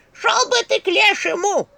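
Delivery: high, loud, slow curse; yapping back. A narrator's reading. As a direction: squawk an angry send-off in character. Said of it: "вякнула Черепуша". (сварливый выкрик, высоко и громко)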